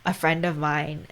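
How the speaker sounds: ordinary talk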